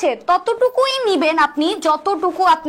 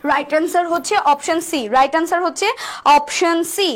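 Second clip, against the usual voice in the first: ordinary talk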